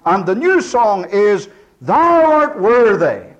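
fervent pulpit proclamation; preaching at full force. Acted. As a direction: ring it out over the congregation. Preacher describing heavenly worship.

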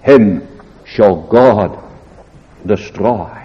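a slow, hushed warning from scripture; solemn reading. Shown as a demonstration. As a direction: let the judgment fall slowly. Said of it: "How solemn is the word".

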